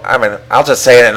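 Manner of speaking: usual speaking voice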